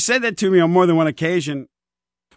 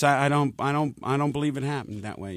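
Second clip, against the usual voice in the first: sad; 2 semitones lower, 7 dB quieter than usual. Retold or imitated. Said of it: "then the sad moments".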